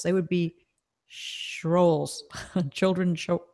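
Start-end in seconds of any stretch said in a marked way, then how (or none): 1.1-2.2 "shrolls" with sh for tr. Shown as a demonstration. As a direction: blend shill and troll as "shrolls". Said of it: "more like trolls".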